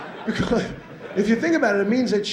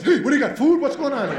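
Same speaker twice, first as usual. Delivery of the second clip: higher, laughing dog voice; eager dog voice; 7 semitones higher than usual. Retold or imitated. Dog greeting its owner, eager for food and a bit higher. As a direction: play the dog, bouncing and eager. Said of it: "and he goes".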